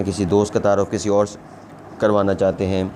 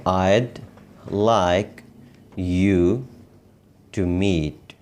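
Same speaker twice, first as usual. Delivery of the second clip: slow, a clear pause between words; word-by-word model; 2 semitones lower, much slower than usual. Shown as a demonstration. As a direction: dictate the phrase piece by piece. Teacher models phrase word by word.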